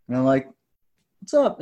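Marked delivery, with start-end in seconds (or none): 1.2-1.6 much higher "What's up?" falling at the end; puzzled reply. Retold or imitated. Newcomer answers the stranger's greeting, high and puzzled. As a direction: answer back confused, pitched way up.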